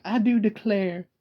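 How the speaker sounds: mocking the fake Southern accent from movies; mock movie Southern belle accent. Mocking Steel Magnolias actresses' fake Southern belle accent. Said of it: "the accent that those actresses use in that movie".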